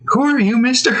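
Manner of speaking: put-on high girlish question; puzzled young girl. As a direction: ask the stranger innocently. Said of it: "she's like".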